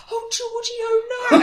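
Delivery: high, wailing imitation of dismay; hammed-up high-pitched lament; mock dismay. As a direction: wail it, half laughing. Everyone's dismay, acted out.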